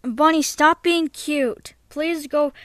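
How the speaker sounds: ordinary talk, nothing marked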